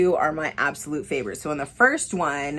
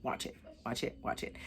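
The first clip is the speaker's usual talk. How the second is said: whispered, much softer insistence; urgent whisper; 6 semitones lower, 17 dB quieter than usual. Real, not acted. Vlogger urging her viewers to see the film, in a hushed whisper. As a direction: lean in and whisper it like a secret.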